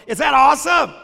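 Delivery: loud, high burst of enthusiasm; excited shout. Real, not acted. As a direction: shout it out to the crowd, thrilled. Showing off the old game.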